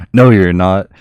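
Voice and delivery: loud heroic retort; triumphant shout. Retold or imitated. Lando answers the outnumbered fleet with a loud heroic retort. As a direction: call it out like the cavalry arriving.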